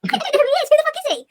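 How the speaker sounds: mocking Kevin. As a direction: squeal it like a baffled Kevin. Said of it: "Kevin's like".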